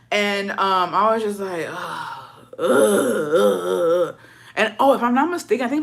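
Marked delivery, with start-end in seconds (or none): 1.7-4.2 string of drawn-out groans; disgusted groans. Retold or imitated. Reacting to grandma's rudeness, exasperated groans.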